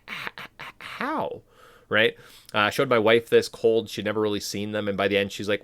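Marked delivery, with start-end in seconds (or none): 0.1-1.4 high, soft stammering mock sob; mock tearful. Viewer watching him lowered into lava, mock crying. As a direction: choke out the word in pretend tears. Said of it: "and you're crying".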